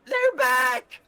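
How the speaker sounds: loud, high-pitched shout; old lady's yell. Acted. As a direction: yell it out like an old woman raising the alarm. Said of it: "hear the old lady yell".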